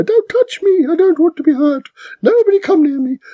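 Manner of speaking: high, scared little voice; timid turtle voice. Retold or imitated. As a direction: squeak it like a cowering turtle. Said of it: "normally I'm turtle in the corner".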